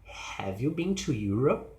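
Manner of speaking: emphasis on final "Europe", lifting; yes-or-no question rising on "Europe". Shown as a demonstration. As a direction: pitch up at the end of "Europe". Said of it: "emphasis is the final part".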